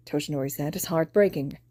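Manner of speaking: usual speaking voice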